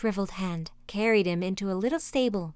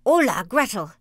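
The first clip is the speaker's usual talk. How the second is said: loud, impatient call; witch's cry; 7 dB louder than usual. A narrator's reading. Impatient witch summons Gretel, loud cry. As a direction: call out sharply to summon the girl. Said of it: "she cried to the girl".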